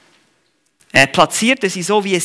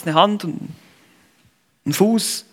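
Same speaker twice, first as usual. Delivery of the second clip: ordinary talk, nothing marked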